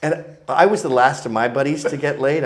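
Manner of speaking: ordinary delivery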